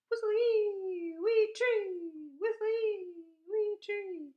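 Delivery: high, loud whistled birdsong; starling song. Retold or imitated. Reading a bird book aloud, high whistled starling call. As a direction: sing it like a starling on a pole.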